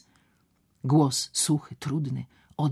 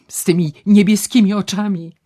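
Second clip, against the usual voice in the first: exasperated groan rising louder; 8 dB louder than usual. Acted. Doctor groans at Ewa's romantic devotion. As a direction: groan it out, building. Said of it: "jęknął doktor Wilgosiński".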